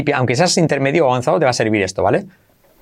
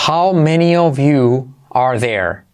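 slow, careful teaching delivery; drawn-out, deliberate demonstration; slow model phrase; slower than usual. Shown as a demonstration. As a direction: spell the question out slowly for learners. Teacher models correct phrase slowly.

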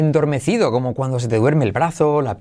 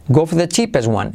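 quick "go for the", R dropped in for; said fast; 3 semitones higher, faster than usual. Shown as a demonstration. Teacher shows learners fast speech, for loses its R. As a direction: run go for the cheapest one together. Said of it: "al decirlo rápido ese for, la R decae".

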